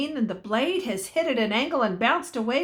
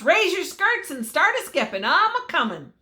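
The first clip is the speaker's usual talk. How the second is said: loud, high, rowdy calling; shout; 7 semitones higher, 4 dB louder than usual. A narrator's reading. Cowhand shouting to the dancers below, rowdy and loud. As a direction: whoop it across the valley. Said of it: "he shouts".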